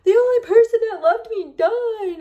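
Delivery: put-on high, loud melodramatic teenage wail. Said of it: "when you're a teenager".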